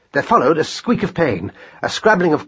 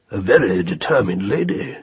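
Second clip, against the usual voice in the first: lower, measured rabbit voice; deep tones; 4 semitones lower than usual. A narrator's reading. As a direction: remark it deeply and drily. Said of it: "he said in his deep tones".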